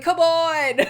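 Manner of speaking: ordinary talk, nothing marked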